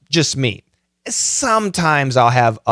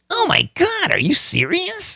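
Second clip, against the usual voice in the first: high-pitched silly put-on voice; 3 dB quieter than usual. Shown as a demonstration. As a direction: squeal it in a goofy character voice. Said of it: "I just do silly voices".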